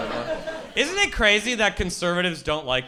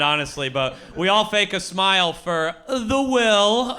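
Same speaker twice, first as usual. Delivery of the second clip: ordinary talk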